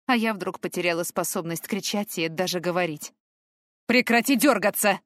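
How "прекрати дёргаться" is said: gruff man snapping the order, louder; growled order. Acted. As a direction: bark it at the struggling girl. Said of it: "рыкнул мужчина".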